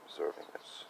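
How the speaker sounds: soft, whispered, unhurried; just watch it, breathe it out quietly; calm observing murmur. Shown as a demonstration. Showing how to observe feelings, a soft calm murmur. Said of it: "Just like".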